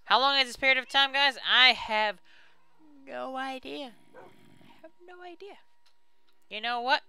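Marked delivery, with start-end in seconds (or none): 1.5-3.9 clueless admission. Real, not acted. Admitting ignorance to viewers. 4.1-5.6 very soft, high, slower squeak; tiny-voiced admission. Real, not acted. Player clueless about the waiting time, tiny high voice.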